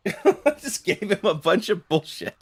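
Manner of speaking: laughing through the words; real amused chuckle through the line. Real, not acted. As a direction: crack up while repeating it. Repeats line while laughing.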